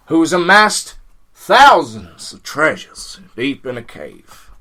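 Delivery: loud, slow, deep-toned narrator voice; bad Morgan Freeman drawl, unhurried and grand; Morgan Freeman impression. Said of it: "voiced by a terrible Morgan Freeman impression".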